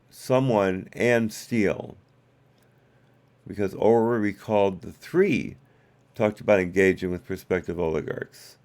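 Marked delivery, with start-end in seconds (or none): none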